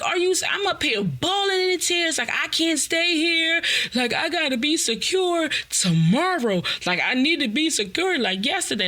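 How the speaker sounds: high, frantic mock urgency. Telling sister she's crying, frantic urgency.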